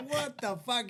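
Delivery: high, alarmed exclamation; retold panic. Teen driver after blowing through intersection, retold panic. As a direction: blurt it in mock alarm.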